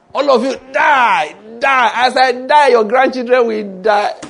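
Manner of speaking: high, loud, drawn-out curse; mock cursing shout. Preacher acts Stephen cursing his killers, mock furious shouting. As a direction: hurl a hammed-up death curse at them.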